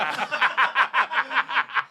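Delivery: a real burst of laughter; laughing. Real, not acted. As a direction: laugh out at the joke. Laughs at his hammer.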